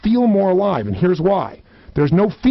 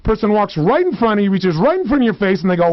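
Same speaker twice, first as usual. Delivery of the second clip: ordinary talk, nothing marked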